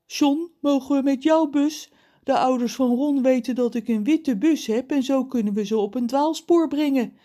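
a hint of a giant laying out a plan. A narrator's reading. Reus asks John for the bus, lightly voiced giant.

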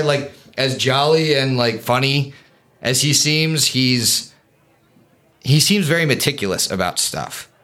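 normal talking voice

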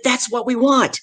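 high-pitched, insistent imitation; pushy demand. Hiring lady gets in speaker's face before her turn, insistent. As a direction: press the point as the pushy organiser.

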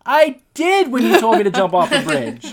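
loud, high-pitched mock outrage; indignant comeback. Candidate protesting to his friend, comically indignant. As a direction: wail it as a hammed-up protest.

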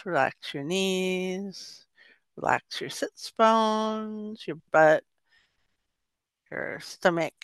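slow body scan with drawn-out words; unhurried meditation guide, pausing between phrases; guided meditation. Shown as a demonstration. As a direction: lead them through relaxing, stretching each body part. Leads a body-relaxation meditation.

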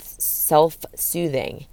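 drawn out slowly and softly; lingering, calming delivery; slow, soft self-soothing. Shown as a demonstration. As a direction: soothe the word out gently. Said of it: "It's called self".

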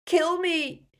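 high, tormented scream; Dumbledore. A narrator's reading. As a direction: cry out in agony. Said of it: "than he yelled".

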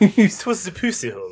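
hammed-up old-time British accent. Joking on British slang, old British accent. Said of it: "Old British".

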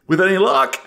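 high, loud gleeful threat; menacing old creature. Acted. Creature answers whether he will hurt them, high and loud.

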